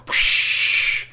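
breathy rushing whoosh; whoosh sound. Acted. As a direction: sound of water pouring out. Kids pour in.

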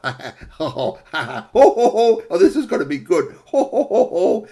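loud, high ho-ho laughter; gloating villain laugh. Acted. Fox relishing rabbit's torture, evil laughter. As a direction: build to a booming cackle.

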